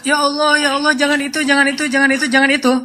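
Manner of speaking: doa bernada tinggi yang diulang-ulang; doa memohon. Retold or imitated. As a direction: menirukan orang berdoa mendesak, nada tinggi. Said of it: "Dia berdoa". (menirukan doa memohon dengan nada tinggi)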